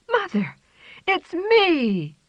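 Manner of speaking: high, loud call to mother. A narrator's reading. Kitten calling out to mother.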